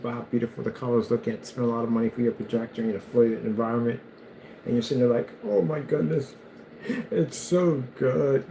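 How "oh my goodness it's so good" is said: slow, awed, mock-weepy; milk it like food is making you cry; mock tearful awe. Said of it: "start crying".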